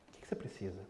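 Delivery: very soft, low and gentle; tender teacher. Retold or imitated. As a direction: ask softly, as if kneeling beside a crying child. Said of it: "o professor com ternura falando assim".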